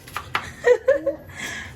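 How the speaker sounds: breathy high giggle; high laugh. Real, not acted. Laughing at nervous husband.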